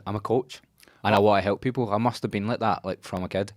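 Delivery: normal talking voice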